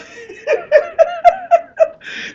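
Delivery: squealing high laugh; high cackle. Real, not acted. Telling the story, he bursts into a high cackle.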